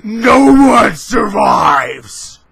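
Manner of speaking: slow, loud, hammed-up victory cry; mock triumphant roar. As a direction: stretch it into a hammy war cry. Triumphant after killing enemies.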